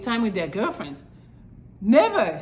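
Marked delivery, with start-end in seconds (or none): none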